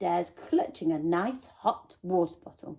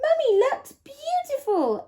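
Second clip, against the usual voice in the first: put-on high, excited little boy voice; high child voice exclaiming. A narrator's reading. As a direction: gush it like a proud young son. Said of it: "exclaimed Lester".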